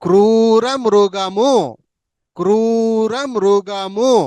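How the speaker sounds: drawn out, every syllable clear; slow clear model. Shown as a demonstration. Teacher modeling a phrase slowly for students. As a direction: spell it out slowly for the class. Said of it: "బాగా పలకండి".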